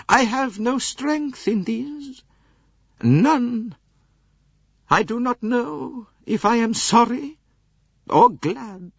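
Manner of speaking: put-on high, slow haughty old lady; lofty aged princess voice. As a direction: voice the elderly princess, cool and proud. Princess voice held.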